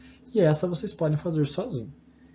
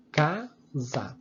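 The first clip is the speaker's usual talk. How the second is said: "casa" slowly in two beats, ca, sa; "casa" syllable by syllable, slow and separated; split into syllables. Shown as a demonstration. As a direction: break "casa" after ca, as if clapping each piece. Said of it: "uma palma pra cada pedaço da palavra".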